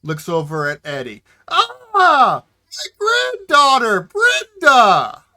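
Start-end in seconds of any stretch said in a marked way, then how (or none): 1.5-5.2 put-on high, loud old-timer's cry of recognition; old man. Old Wilbur mistaking Eddie for his granddaughter, crying out. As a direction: wail it out slowly, hammed-up. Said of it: "he is an older gentleman, quite old".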